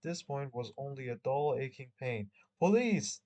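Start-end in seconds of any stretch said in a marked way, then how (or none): 2.6-3.2 loud exclamation. Acted. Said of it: "whispered again in a thick accent".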